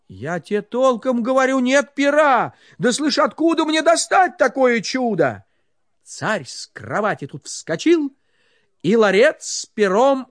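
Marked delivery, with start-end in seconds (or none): 0.1-5.4 agitated. Acted. Ivan pleads innocence.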